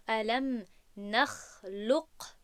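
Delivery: slow «الم نخلق» with the final qaf released on its own; wrong unmerged qaf. Shown as a demonstration. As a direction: bounce the qaf at the end of «نخلق». («الم نخلق» با قاف جدا و آشکار، بدون ادغام)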